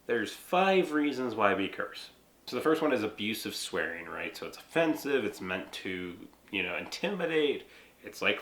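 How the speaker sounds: ordinary talk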